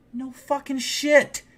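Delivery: very high, drawn-out mock exasperation; incredulous retort. Answering the man in the wrong apartment, incredulous retort. Acted exasperated reply to intruder.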